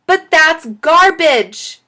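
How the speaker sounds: high, loud, drawn-out objection; disgusted protest. A narrator's reading. Chloe objects to scraps in the worm bin, disgusted. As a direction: drag out the word in disgust.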